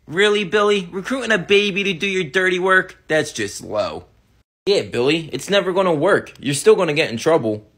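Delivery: mocking teen brothers. Brothers mock Billy's plan, low and sneering. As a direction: scoff at the little kid.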